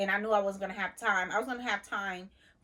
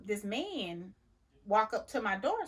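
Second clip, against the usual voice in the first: usual speaking voice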